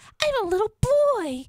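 high pretend-child voice; little boy. Co-host plays his little kid, high boy voice. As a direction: play up the small boy. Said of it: "Here comes his little kid".

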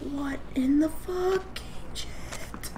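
high scared whisper. Real, not acted. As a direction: whisper it in disbelief.

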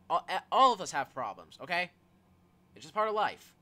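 emphatic reassurance. Acted. Friend comforting someone who feels flawed, emphatic reassurance. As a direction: drive the comfort home loudly.

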